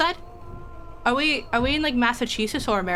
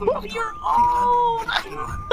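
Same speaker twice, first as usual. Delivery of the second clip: high, drawn-out cry of surprise; exaggerated shocked wail; startled exclamation; 11 semitones higher, much slower than usual. Real, not acted. Reacts to old passenger.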